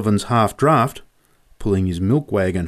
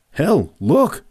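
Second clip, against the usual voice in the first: startled shout; 7 semitones higher than usual. A narrator's reading. Boy shouting to Joe as horses crest the hill, startled. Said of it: "I shouted".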